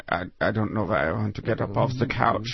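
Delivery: put-on lazy Greek accent voice. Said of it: "speaking with a Greek accent".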